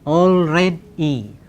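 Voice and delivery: ALREADY slowly split into three syllables. Shown as a demonstration. Teacher showing students three syllables, ALREADY said syllable by syllable. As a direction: break ALREADY into three slow, separate beats. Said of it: "We have three syllables".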